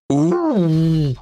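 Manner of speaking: loud, wailing animal whimper; howling moose. A narrator's reading. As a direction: howl like a wounded moose. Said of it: "подвывала Зан".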